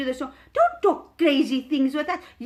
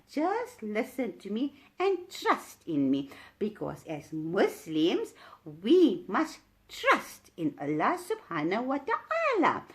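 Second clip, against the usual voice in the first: calm reassurance; 6 semitones lower, 5 dB quieter, slower than usual. Acted. Voicing Allah's reply.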